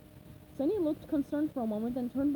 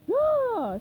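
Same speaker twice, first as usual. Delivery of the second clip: loud high shocked gasp; startled cry; 11 semitones higher, 9 dB louder than usual. Acted. Squidward startled by SpongeBob at the window, loud yelp. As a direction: jump with a yelp of fright. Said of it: "Squidward cried, startled".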